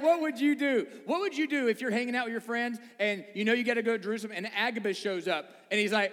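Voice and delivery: ordinary delivery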